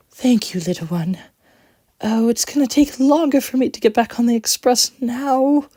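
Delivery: high, upset whimper. A narrator's reading. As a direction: whimper it, still hurt. Coach voice.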